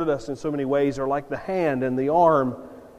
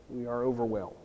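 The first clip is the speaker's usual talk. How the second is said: softer, falling "overwhelmed"; soft echo; 3 semitones lower, 7 dB quieter than usual. Real, not acted. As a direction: let "overwhelmed" sink in quietly. Preacher repeats the point softly.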